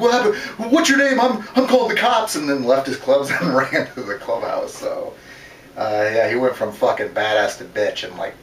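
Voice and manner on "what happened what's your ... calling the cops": much higher, mocking frantic voice; confused panic. Woken-up golfer panics and threatens cops, high frantic mockery. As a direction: play him dazed and scared, squeaking for help. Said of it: "He's all confused".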